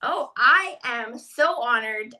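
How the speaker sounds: normal talking voice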